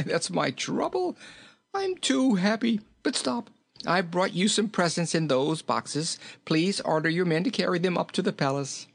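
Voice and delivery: high-pitched merry character voice; too happy to keep from chuckling; jolly, chuckling king. A narrator's reading. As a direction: bubble over with laughter between phrases.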